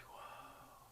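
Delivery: breathless unvoiced whoa, very soft; whispered whoa. Stunned by the owner's remark, whispered whoa. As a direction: let it slip out under the breath.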